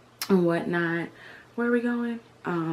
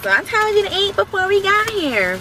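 high, louder nagging mom voice; fussing mom; 12 semitones higher, 5 dB louder than usual. Retold or imitated. Daughter imitating mom fussing about eating before the trip. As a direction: scold like a mom fussing at her kid. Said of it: "she was fussing at me".

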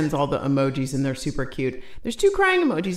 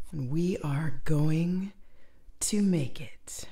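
slow, soft sultry whisper; 6 dB quieter, slower than usual. Pretend. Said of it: "I was going for the sultry voice".

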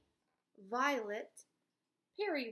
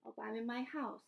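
ordinary talk, nothing marked

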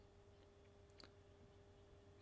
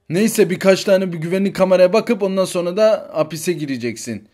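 ordinary talk, nothing marked

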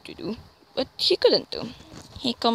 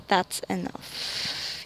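whispered, slow and soft; hushed line; 2 semitones lower, 6 dB quieter, much slower than usual. Acted. Levi pulling the reader close, whispering slowly.

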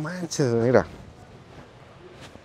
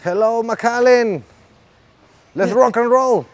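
mock concert greeting, pitched high; drawn-out, higher-pitched showman call; rock frontman; 4 semitones higher, much slower than usual. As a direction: hail the crowd like a rock singer on stage. Plays rock singer on old stage.

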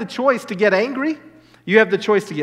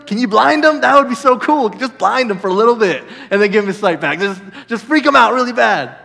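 loud, eager prayer to the Lord; thrilled daydreaming, half laughing at himself; excited; 2 semitones higher, 6 dB louder than usual. Retold or imitated. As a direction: burst out like a kid who can't wait. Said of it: "I just start getting excited".

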